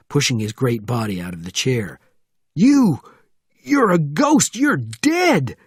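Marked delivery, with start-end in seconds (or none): 2.5-5.6 loud, very high cries of horror; panicked shout. A narrator's reading. Sergeant facing a man he believed dead, panicked shouting.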